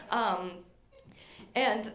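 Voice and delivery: ordinary talk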